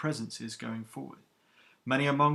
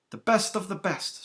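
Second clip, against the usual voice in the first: stern captain, higher and louder than the narration; iron-voiced captain; 7 semitones higher, 5 dB louder than usual. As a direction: rebuke the recruits who kept chattering. Captain with a hint of smile.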